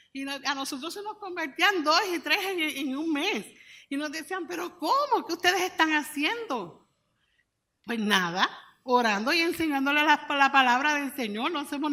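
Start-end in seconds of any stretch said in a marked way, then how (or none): 4.5-6.9 high, loud astonished query; imitating baffled colleagues, voice raised; amazed question. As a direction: ask in retold disbelief, pitch jumping up. Other pastors' amazed question retold.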